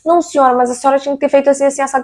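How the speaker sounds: fast, high, dismissive imitation of the contractor; lightly voiced smug worker; mock worker excuse. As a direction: parody his know-it-all tone.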